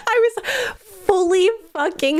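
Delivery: high laugh through the words. Real, not acted. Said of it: "laughs while speaking".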